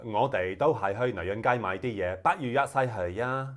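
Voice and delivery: loud, lilting Cantonese recital; Cantonese demo. Shown as a demonstration. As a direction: recite the textbook sentence loudly in Cantonese. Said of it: "I can actually say something quite random in".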